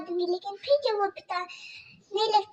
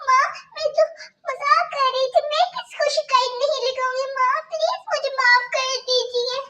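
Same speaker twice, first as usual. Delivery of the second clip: put-on much higher, small pleading girl voice; pleading young girl; 6 semitones higher, 4 dB louder than usual. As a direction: Kajal begging her stepmother about stomach pain. Kajal pleads.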